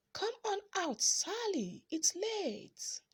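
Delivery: high call into the dark. A narrator's reading. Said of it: "both boys yelled out".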